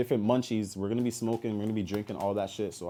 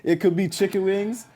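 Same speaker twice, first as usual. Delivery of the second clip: higher and louder, buzzing; excited; 9 semitones higher, 5 dB louder than usual. Real, not acted. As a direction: say it hyped, still laughing. Repeats the teaser, excited.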